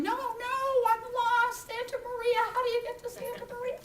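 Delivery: high-pitched pleading call; retold yelling. Lost woman yelling at a departing car, acted high plea. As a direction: re-enact calling after them, speeding up at the end. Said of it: "and I'm yelling".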